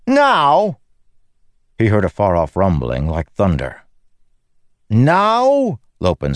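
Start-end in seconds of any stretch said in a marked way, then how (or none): 0.1-0.8 high, loud incredulous cry; indignant outburst. Acted. Lopen indignant at the sky. 4.9-5.8 high, loud incredulous cry; indignant outburst. Acted. Lopen shakes fist at sky.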